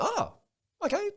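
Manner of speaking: a startled, squeaky high okay; surprised. Retold or imitated. Retelling his reaction to the Hinge date, surprised. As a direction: caught off guard, voice jumps up. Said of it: "I'm like".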